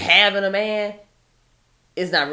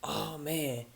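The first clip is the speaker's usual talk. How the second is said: softer, put-on dude voice; imitating a dismissive guy; 2 semitones lower, 8 dB quieter than usual. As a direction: drop it like a bored guy sneering. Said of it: "all these bums hear is".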